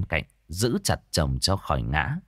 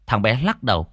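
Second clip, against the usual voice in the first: usual speaking voice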